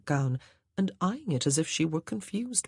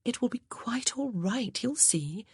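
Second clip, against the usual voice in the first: whispered, softer comfort; soft reassurance; 8 semitones higher, 4 dB quieter than usual. A narrator's reading. Grace comforting the anxious maid, softly whispered. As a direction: soothe the frightened maid gently. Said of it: "put her arm around ... squeezed".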